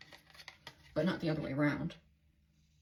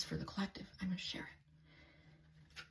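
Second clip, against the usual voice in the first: whispered thought; 13 dB quieter, slower than usual. Retold or imitated. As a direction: whisper it, fading and slowing. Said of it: "And then I was like".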